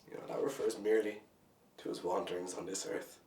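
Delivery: soft, low, spent voice; subdued despair. Acted. Spent, despairing close of monologue.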